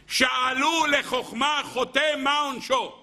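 slow, high-pitched solemn recitation; declaimed quotation. Real, not acted. Preacher reading a Midrash line to his listeners, declaimed. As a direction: draw it out high, posing the ancient question.

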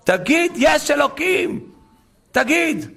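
high, insistent cry; shouted demand. Retold or imitated. Gentile gunman shouting at him to confess, insistent. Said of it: "זה מה שהגוי צעק לו".

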